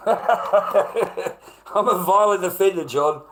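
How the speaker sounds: slow, high, loud and laughing through it; laughing sarcasm. Acted. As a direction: laugh the accusation off as absurd. Sarcastic self-mockery about a label.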